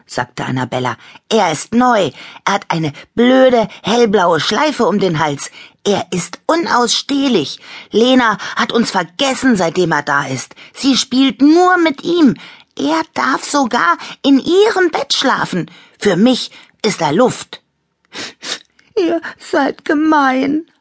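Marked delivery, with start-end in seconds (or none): 1.3-17.6 high-pitched indignant complaint; worked-up, jealous outburst; jealous rant. A narrator's reading. Jealous toy rants about newcomer. 19.0-20.7 high-pitched tearful little voice; high, wailing accusation; sobbing. A narrator's reading. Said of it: "schluchzte Klaus Teddy".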